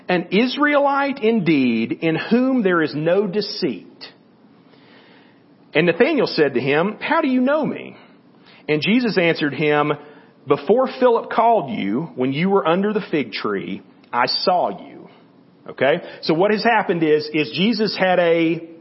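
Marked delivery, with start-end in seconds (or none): 5.7-15.1 raised, louder reading voice for the Bible passage; scripture reading. Acted. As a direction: read it out like a lesson from the pulpit. Reads John chapter one aloud.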